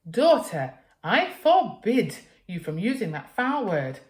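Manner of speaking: high, loud, slow wail; wailing mother. Acted. Mother forbidding the rude word, wailing. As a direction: wail in prim outrage. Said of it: "Mother would wail".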